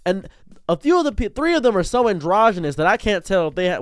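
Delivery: normal talking voice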